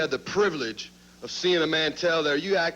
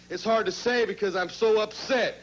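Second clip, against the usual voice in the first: ordinary talk, nothing marked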